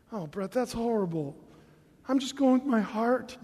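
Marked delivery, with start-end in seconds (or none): 2.1-3.4 choked-up sentiment. Shown as a demonstration. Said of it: "You gotta kinda choke a little bit when you say heart".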